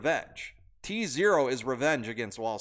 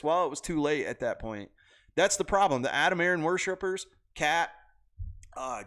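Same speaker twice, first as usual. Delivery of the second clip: ordinary talk, nothing marked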